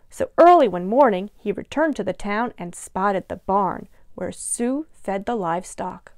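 usual speaking voice

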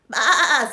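loud bleating baa; sheep baa. A narrator's reading. Storyteller plays the sheep, loud bleating. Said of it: "said the sheep".

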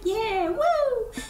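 high-pitched crowd cheer; put-on high voice, whooping; cheering villager. Villagers cheering the free money, high and excited.

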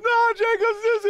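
high, loud tearful whine; mock crying. Rappers pleading with their DJ, mock crying. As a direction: wail the protest like a kid. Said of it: "we crying and stuff like that".

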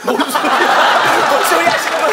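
high-pitched, incredulous outburst; indignant protest. Acted. Comedian reacting to partner asking for an introduction, indignant protest. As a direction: protest in outraged disbelief.

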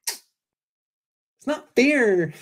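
high, very slow drawn-out whine; kid whine. Retold or imitated. Mocking Kyle complaining, high childish whine. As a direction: stretch it out like a sulking child. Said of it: "I used to talk like that when I was a kid".

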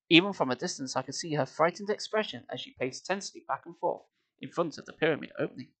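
ordinary delivery, nothing marked

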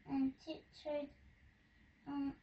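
usual speaking voice

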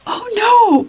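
sudden high panicked cry. A narrator's reading. Eppie seeing Allie at school, panicked high cry. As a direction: gasp it out in alarm. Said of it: "panicked Eppie".

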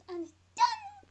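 squeaky cracked high done; voice cracking high. Acted. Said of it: "voice cracks".

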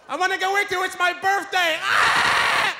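mock the eager cousin shouting; mimicking cousin.

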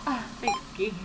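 ordinary talk, nothing marked

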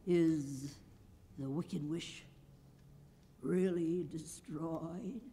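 trembling a little. A narrator's reading. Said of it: "thought it trembled a little".

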